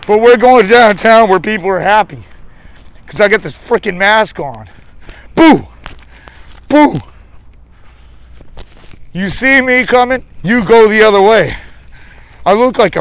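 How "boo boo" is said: playful loud boo, pitched up; mock scare. Streamer booing at people on the sidewalk, playful shout. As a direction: jump out and boo at strangers. Scaring passers-by in a mask.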